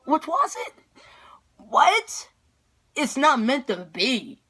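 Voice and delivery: high, punchy bursts of disbelief; hammed-up stunned repeats, voice rising; retold outraged disbelief. Daughter to dad who says it's not meant, outraged disbelief. Outraged reply to dad's remark.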